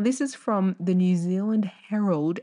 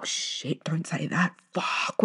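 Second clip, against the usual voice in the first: whispered; slower than usual. Real, not acted. Catches herself swearing.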